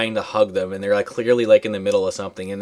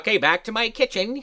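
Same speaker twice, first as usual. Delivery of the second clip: higher compliant chirp; meek grandma; 10 semitones higher, 3 dB quieter than usual. Retold or imitated. Friend imitates grandma obeying cheerfully, higher. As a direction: go along obediently. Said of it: "She's like".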